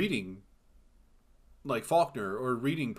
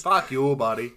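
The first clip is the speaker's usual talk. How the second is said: loud mock-Canadian mobster jab; Canadian mobster accent; 7 dB louder than usual. Co-host imitating the Canadian mob, loud Canadian accent. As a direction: bellow it like a Canadian hoser. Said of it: "the most Canadian accents you hear".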